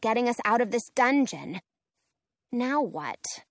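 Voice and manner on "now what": ordinary delivery, nothing marked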